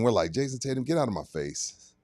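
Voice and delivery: ordinary talk, nothing marked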